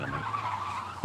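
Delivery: ordinary talk, nothing marked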